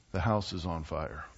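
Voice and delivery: very low, soft and level, no excitement at all; completely calm. Retold or imitated. Wife calmly telling husband the house burns, flat and soft. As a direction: announce the disaster as if mentioning the weather. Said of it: "she says completely calmly, without any excitement in her voice".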